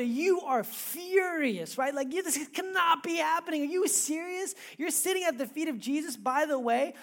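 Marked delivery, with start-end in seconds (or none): 2.1-6.2 indignant Martha. Retold or imitated. Martha furious at Mary.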